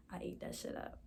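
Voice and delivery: unvoiced whispered boast. Real, not acted. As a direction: whisper it to yourself, pleased. Self-praise muttered after rhyming.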